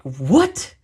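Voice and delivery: incredulous What, pitched high; indignant disbelief at the grade; shocked outrage. Retold or imitated. Student reading his C grade, shocked and incredulous.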